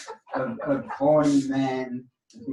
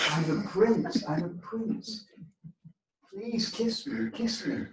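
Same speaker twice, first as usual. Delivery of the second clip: pleading frog; 8 semitones higher, slower than usual. Acted.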